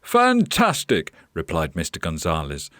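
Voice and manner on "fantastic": high, drawn-out exclamation; slow, high-pitched enthusiasm; delighted owner. Acted. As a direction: welcome the new helper with delight. Owner delighted with new helper.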